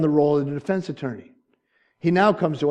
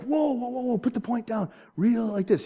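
high, urgent coaching; imitated fishing friend; 7 semitones higher, 3 dB quieter than usual. Friend tells him how to reel, imitated urgency. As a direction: imitate a friend calling out instructions, pitched high.

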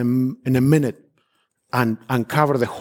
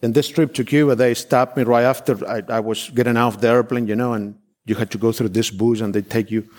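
ordinary talk, nothing marked

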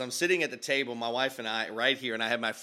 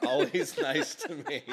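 laughing through the words, higher and livelier; 5 semitones higher than usual. Real, not acted. Husband laughing as he teases his wife about being nice. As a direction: giggle as you backpedal the joke.

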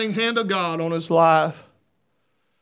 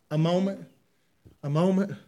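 ordinary delivery

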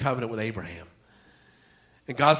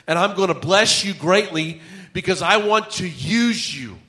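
raised, louder preaching voice; higher-pitched, driving sermon delivery; impassioned preaching; 6 semitones higher, 7 dB louder than usual. Acted. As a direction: preach it with mounting urgency.

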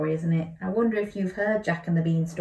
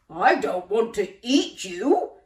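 giant gasping; 3 semitones higher, 6 dB louder, slower than usual. A narrator's reading. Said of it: "gasped the giant".

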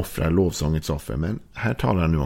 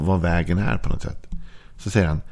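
ordinary talk, nothing marked

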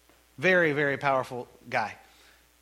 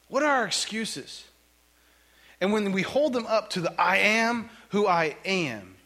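preaching; 8 semitones higher than usual. Acted. Pastor presses the church about God's power, preaching hard. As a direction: drive the point home to the congregation.

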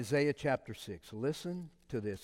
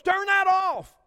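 high, loud imitated command; mock order; 11 dB louder, slower than usual. Wife telling husband to shut off the video, loud mock order. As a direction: snap the order like the wife did.

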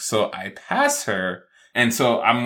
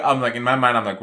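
normal talking voice

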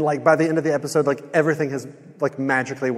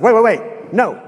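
louder, higher mock protest; acted-out sudden interruption, pitched up; abrupt objection; 7 semitones higher, 6 dB louder than usual. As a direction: cut in to halt the waitress. Acted sudden objection to usual order.

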